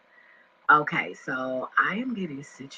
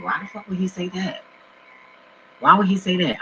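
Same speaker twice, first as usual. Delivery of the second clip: ordinary talk, nothing marked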